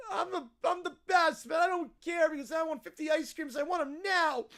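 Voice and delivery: tearful, shouty bratty demand; mock-crying tantrum voice; whiny kid. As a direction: demand it like a spoiled child. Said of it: "peak whiny kid territory".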